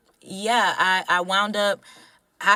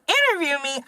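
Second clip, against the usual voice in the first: pitched-up disbelieving "Interview me?"; surprised question; 9 semitones higher than usual. Retold or imitated. Girl reacting to her parents' news, high with surprise. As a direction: sound caught off guard.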